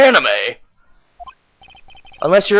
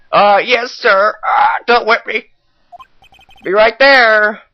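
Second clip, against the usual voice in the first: timid underling; 5 semitones higher, 4 dB louder, slower than usual. Acted. Detective calls back to boss.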